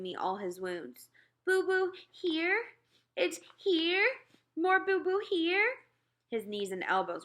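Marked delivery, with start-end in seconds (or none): 1.4-5.8 put-on toddler voice. Toddler shows his wounds.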